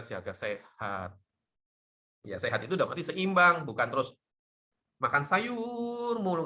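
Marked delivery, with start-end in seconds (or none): 5.0-6.5 high, slow mocking emphasis; drawn-out mock; mocking stretch. Real, not acted. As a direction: poke fun at the all-veggie eater. Mocking veggie-only diet.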